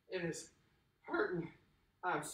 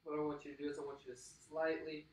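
calm breathing guide; 4 semitones lower, 5 dB quieter than usual. Acted. Coach guiding the cool-down breathing, soft and calm. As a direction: ease them into the stretch.

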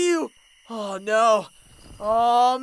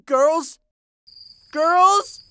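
calling out; 3 semitones higher, 5 dB louder than usual. Acted. Searching for the missing girls.

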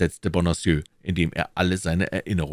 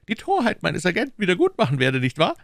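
higher, quick mock of the Cardinal; Cardinal's mocking words; 14 semitones higher, 4 dB louder, faster than usual. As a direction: finish the sentence for him. (spöttisch ergänzt)

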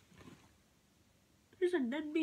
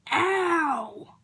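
loud sharp cry of pain; 12 dB louder than usual. Real, not acted. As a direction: yelp out loud. Clothespins hurt her face.

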